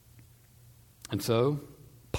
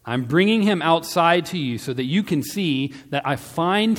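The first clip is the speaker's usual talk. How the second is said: raised, projected announcement; public declaration; 6 semitones higher, 8 dB louder than usual. A narrator's reading. Pilate presenting Jesus to the crowd, loudly declaring. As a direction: declare it high and loud to a crowd. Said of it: "went outside and declared".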